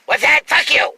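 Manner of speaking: hammed-up rude guy; rude jerk voice. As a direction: bark it like a belligerent stranger. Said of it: "In that voice, though".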